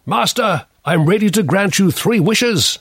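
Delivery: high-pitched genie voice; grand genie offering wishes. Acted. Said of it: "says the genie".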